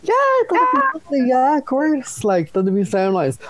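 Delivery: very high, put-on delighted sweetness; Brie Van de Kamp. Dater accepting coffee on best behaviour, hammed-up high sweetness. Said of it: "pretending like I'm Brie Van de Kamp from Desperate Housewives".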